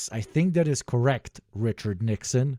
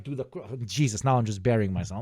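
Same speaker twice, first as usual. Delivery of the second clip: ordinary talk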